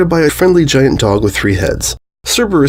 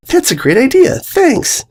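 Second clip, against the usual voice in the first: bright and cheery. Acted. Candy cheerfully thanks Tirek.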